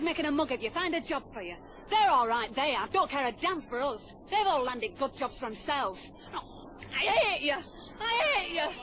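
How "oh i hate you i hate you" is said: anguished outburst. Acted. Quarrel, girl breaks down.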